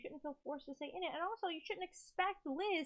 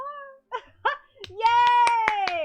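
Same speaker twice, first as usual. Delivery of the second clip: very loud, high joyful laugh; high delighted laugh; 10 semitones higher, 17 dB louder than usual. Real, not acted. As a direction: burst out laughing happily. Laughing with joy.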